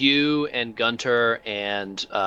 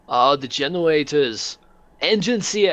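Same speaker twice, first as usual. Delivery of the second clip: calling out; 6 semitones higher than usual. Acted. GM voices Crumb calling.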